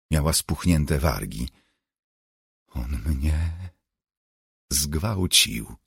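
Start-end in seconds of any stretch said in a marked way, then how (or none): 2.7-5.7 soft, halting near-whisper. A narrator's reading. Lisa tells Ginny of the attack, whispering. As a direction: barely get it out, with a break midway. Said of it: "wyszeptała".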